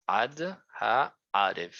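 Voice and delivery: Ad Ha'arev said slowly, syllable by syllable; Hebrew pronunciation. Shown as a demonstration. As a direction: spell out Ad Ha'arev carefully. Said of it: "Se pronuncia".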